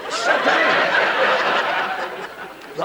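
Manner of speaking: high airy effortful grunt; straining to lift himself out of a chair; mock strained grunt. Old man mocking his own struggle with the freezer, straining. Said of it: "open the freezer and go".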